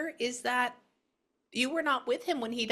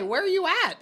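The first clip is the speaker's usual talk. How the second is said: high, loud eager question; louder and higher, excited; excited call; 10 semitones higher, 6 dB louder than usual. Retold or imitated. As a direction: ask it loudly and eagerly. Loud retold question to spirit.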